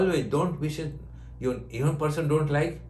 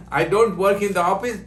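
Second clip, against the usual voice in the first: laughing; 6 semitones higher, 7 dB louder than usual. Real, not acted. Laughing through money joke.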